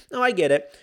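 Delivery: ordinary talk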